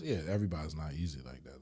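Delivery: ordinary talk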